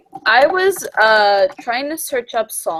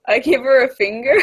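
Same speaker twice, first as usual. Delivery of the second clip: ordinary delivery, nothing marked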